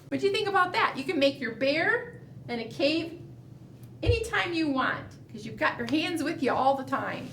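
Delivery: ordinary delivery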